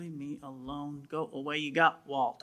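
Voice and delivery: softly pushing away the call to preach; quiet brush-off. Retold or imitated. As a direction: wave it off under your breath.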